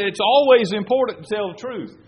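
loud, high insistence; emphatic declaration. Retold or imitated. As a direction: hammer home the lesson to the congregation. Preacher repeating friend's line.

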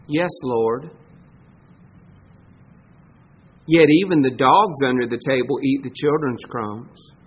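bow your head and answer gently; humble reply. Pretend. The woman answering Jesus, low and meek.

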